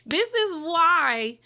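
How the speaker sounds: high, loud, laughing it out; cracking up as she repeats it. Real, not acted. Laughing at the harsh online comments.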